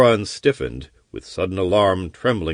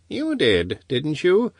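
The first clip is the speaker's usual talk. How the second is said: dry chuckle; 8 semitones higher than usual. Acted. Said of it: "chuckled the wizard dryly".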